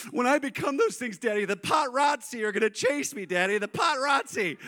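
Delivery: put-on high wailing little-girl voice; mock tearful child. Daughter fearing she'll be chased, high mock-tearful wail. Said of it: "and she started crying".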